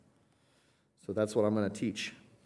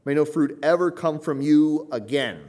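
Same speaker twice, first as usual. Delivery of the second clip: loud and high, stress on "ever"; a curse spoken over the tree; final decree; 5 semitones higher, 8 dB louder than usual. Shown as a demonstration. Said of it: "the finality of the statement".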